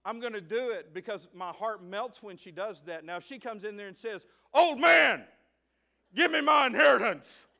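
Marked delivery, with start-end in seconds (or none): 4.5-7.5 put-on loud, high shout of a bratty child; a bratty daughter yells at her father for her inheritance; rude demand. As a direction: bark the demand at your dad.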